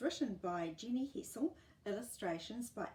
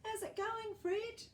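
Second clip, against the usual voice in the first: put-on much higher boy calling across the hedge; 14 semitones higher, 4 dB louder than usual. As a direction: call over to Fred. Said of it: "I would call".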